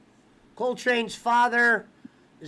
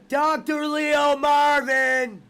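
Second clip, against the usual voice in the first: slow, higher, louder echo of the name; echoed mock voice; 4 semitones higher, 4 dB louder, much slower than usual. Host echoing his cohost's impression, slow mock voice. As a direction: repeat it back laughing, drawn out.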